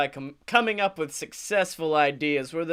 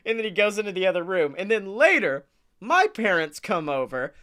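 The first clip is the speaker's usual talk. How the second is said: ordinary talk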